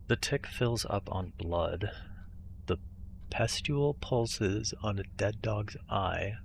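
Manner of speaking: slow, ominous recitation; ominous reading. Acted. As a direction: intone it like a dark omen. Reads ominous game text slowly.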